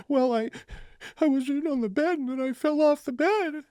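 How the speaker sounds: put-on very high whimpering kid voice; crying child. Nephew explaining his fall to uncle, imitated crying child. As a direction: imitate a tearful little boy explaining. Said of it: "he's crying".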